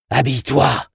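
barked order. Acted. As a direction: order someone sharply, voice pushed up. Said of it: "il entendit l'un des étrangers ordonner".

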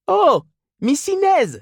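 very high, drawn-out woman's call; Mrs Reilly calling out, accented. A narrator's reading. Mrs Reilly hails Miss Inez at bakery, calling out. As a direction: call the saleswoman in a high, slow singsong. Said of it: "lança madame Reilly avec cet accent".

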